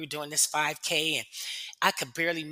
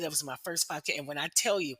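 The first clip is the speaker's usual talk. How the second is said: ordinary delivery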